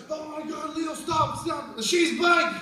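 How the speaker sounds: high-pitched panicked cry, jumping loud at the end. Retold or imitated. Kids warning Leo he is crushing the bike, panicked. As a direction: imitate kids yelling stop in alarm.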